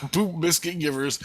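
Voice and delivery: high, laughing through the words. Real, not acted. Laughing at his own dream story, high and laughing.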